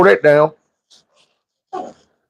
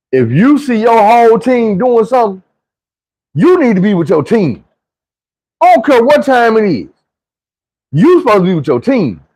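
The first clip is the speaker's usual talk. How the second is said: higher stern lecture, each line punched; lecturing coach; 8 semitones higher than usual. Retold or imitated. Coach scolds the player in front of everyone, higher and stern. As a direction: scold him about the team. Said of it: "And he was like".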